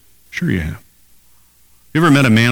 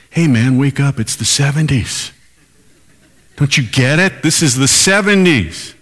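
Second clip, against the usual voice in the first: put-on higher, pushy young voice scoffing at the preacher; mocking youth; 4 semitones higher than usual. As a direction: play the cocky teenager telling him to wake up. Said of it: "here's what they would say".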